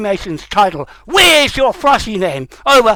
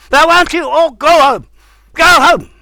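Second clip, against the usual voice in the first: high, loud dismissal; angry demand; 6 semitones higher, 4 dB louder than usual. Real, not acted. Speaker telling outsiders to leave, angry and forceful. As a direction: order them off forcefully. Angrily ordering others to leave.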